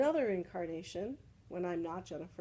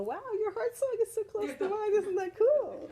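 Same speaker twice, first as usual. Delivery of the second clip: high, bright mock excitement, much higher and louder; excited gush; 14 semitones higher, 8 dB louder than usual. Teacher imitating people who recognize a shared heart song, gushing much higher. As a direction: gush like meeting a long-lost friend.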